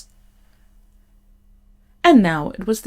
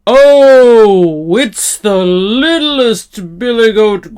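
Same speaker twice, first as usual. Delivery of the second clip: slow, menacing, loud troll; 6 dB louder, slower than usual. A narrator's reading. Troll threatening to eat the littlest goat, slow and loud. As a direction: draw it out like a troll sizing up a meal. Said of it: "the troll in his great loud voice".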